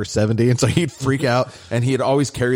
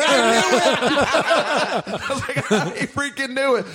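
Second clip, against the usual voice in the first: laughing gloat. Retold or imitated.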